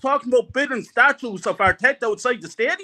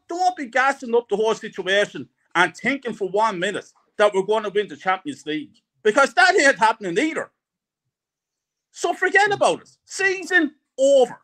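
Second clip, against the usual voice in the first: usual speaking voice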